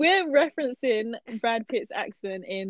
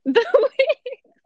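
said through a burst of laughter, pitched up; giggling at the memory of the wigs; laughing; 14 semitones higher, 8 dB louder than usual. Real, not acted. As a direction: laugh the words out in disbelief.

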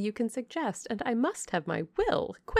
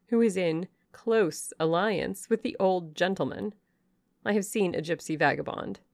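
scornful dismissal; 3 semitones higher, 4 dB louder than usual. Acted. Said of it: "returned Miss Ingram".

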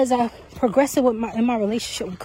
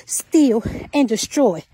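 ordinary talk

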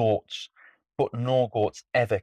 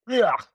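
loud grunt of disgust; 12 semitones higher, 6 dB louder than usual. Acted. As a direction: groan in disgust. Troll disgust sound.

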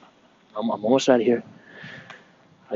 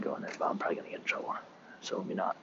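whisper; 9 dB quieter, faster than usual. Real, not acted. Sneaking near off-limits shack.

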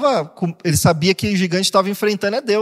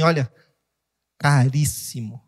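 tender divine voice; 5 semitones lower, slower than usual. Acted. God speaking to the believer.

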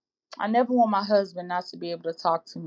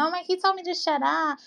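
imitating a complaining sister; 10 semitones higher than usual. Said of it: "I've had a sister that tell me".

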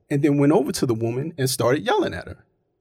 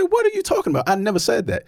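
high-pitched mock protest; indignant denial; 7 semitones higher, faster than usual. Player denying an accusation to officials, retold indignant denial. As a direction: imitate an indignant, higher-voiced denial.

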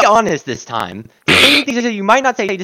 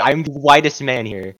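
ordinary delivery

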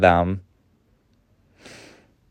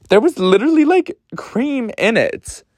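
incredulous; 14 semitones higher than usual. Real, not acted. Incredulous about creamy tequila.

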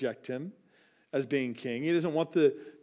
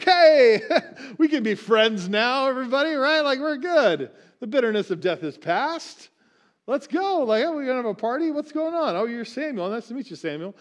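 high, bubbly, laughing party voice, unaware of doom; defeated king Agag greeting Samuel, giddy and high; cheerful Agag; 8 semitones higher, 5 dB louder than usual. Acted. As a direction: greet everyone like a clueless happy guest, much higher. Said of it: "who comes cheerfully".

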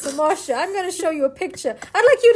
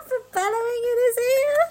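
squeaky voice; 9 semitones higher than usual. Pretend. Squeaky voice mid-story.

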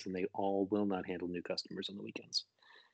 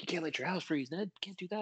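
imitating a fretting broker, pitched up; higher-pitched mock alarm, warning a client; panicking broker; 9 semitones higher than usual. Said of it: "my broker's freaking me out".

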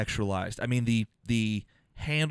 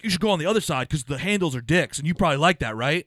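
snide taunt; 5 semitones higher, 5 dB louder, faster than usual. Retold or imitated. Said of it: "this dude was like".

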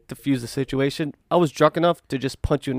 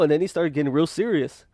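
ordinary talk, nothing marked

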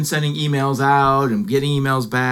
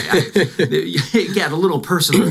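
laughing; 5 semitones higher than usual. Real, not acted. Teasing partner, laughing.

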